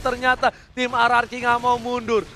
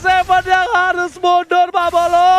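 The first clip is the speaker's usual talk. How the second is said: excited; 6 semitones higher, 7 dB louder than usual. Real, not acted. Hype moment in match commentary.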